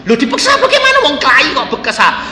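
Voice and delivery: high, fast startled questioning; a father taken aback, asking fast; surprised father. Retold or imitated. Father startled at son's fighting, high and quick.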